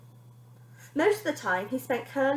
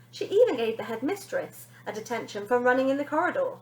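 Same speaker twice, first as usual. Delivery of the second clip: usual speaking voice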